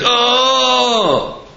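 high drawn-out imitated groan; mock groan. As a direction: groan it out like a man in pain. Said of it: "Here's a groan".

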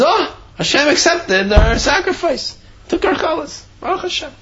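high-pitched joyful wonder; delighted amazement. Acted. Yid finding his challah offering accepted, delighted amazement.

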